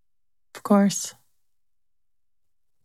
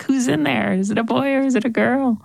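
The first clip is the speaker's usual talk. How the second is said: curious wonder; 6 semitones higher than usual. Retold or imitated. Imagining excited parent wonder.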